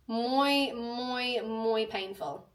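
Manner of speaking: slow, stretched-out mock Spanish; drawn-out emphasis. Real, not acted. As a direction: hold each muy long for comic emphasis.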